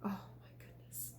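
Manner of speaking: hushed, breathless oh my goodness; whispered aside. Real, not acted. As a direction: whisper it in mock shock. Aside to listeners after moo.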